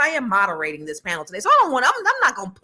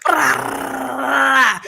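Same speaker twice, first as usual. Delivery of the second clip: loud mock president; 4 semitones higher, 6 dB louder than usual. Voicing the president's tactics.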